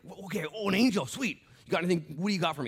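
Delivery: lightly voiced eager John; mock eager John. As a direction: play it like a kid expecting a gift. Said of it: "It's like".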